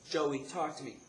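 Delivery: imitating an alarmed mom, high and loud; urgent, high-pitched maternal concern; worried mother. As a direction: plead for an answer in a high mother's voice. Worried mother voiced on phone.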